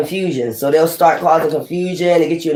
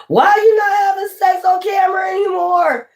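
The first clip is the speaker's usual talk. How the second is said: high, crying mock wail of the frustrated watchers; mock whiny shout. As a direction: wail it like a spoiled voyeur begging.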